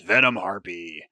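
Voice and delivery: ordinary talk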